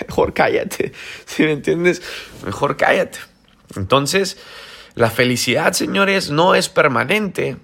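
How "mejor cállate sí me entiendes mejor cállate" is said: whispered with a laugh. Real, not acted. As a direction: tell them to hush, chuckling. Dismissive remark with a laugh. (susurro entre risas)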